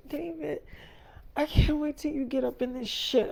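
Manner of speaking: high, soft, needy coo. Retold or imitated. Voicing eager lover at door.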